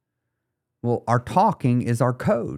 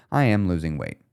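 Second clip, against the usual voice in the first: lower, relaxed statement; super chill; 6 semitones lower than usual. Shown as a demonstration. Coach comparing how the phrases feel, chill. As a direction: say it easy and laid-back. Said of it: "say it super chill".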